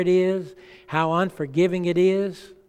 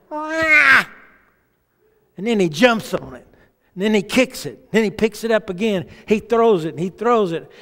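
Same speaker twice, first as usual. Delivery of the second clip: excited storytelling; 4 semitones higher, 4 dB louder than usual. Retold or imitated. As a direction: build it up like a wild scene. Gorilla tossing luggage, vivid retelling.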